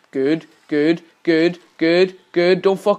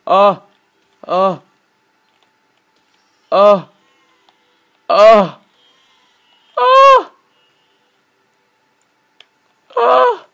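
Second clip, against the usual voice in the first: strained rising grunts; 4 semitones higher, 7 dB louder, much slower than usual. Real, not acted. Straining through a tricky move.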